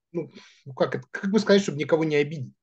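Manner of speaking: ordinary talk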